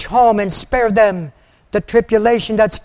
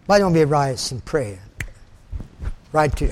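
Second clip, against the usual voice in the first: ordinary delivery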